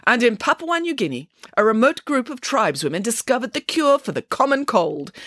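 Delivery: lightly voiced, self-assured newsreader pig; Nanny Piggins reading the news. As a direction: deliver made-up news with lively conviction. Nanny Piggins continues her bulletin.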